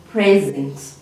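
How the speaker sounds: "Present" pronounced PRE-zent for the class; low, deliberate PRE-zent; stress on first syllable. Shown as a demonstration. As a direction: hit the first syllable of "Present", let the second drop. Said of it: "our emphasis on the first part".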